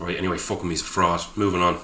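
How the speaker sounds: ordinary delivery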